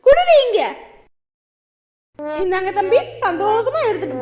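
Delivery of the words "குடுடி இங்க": angry snap. Acted. Snatching phone from daughter-in-law.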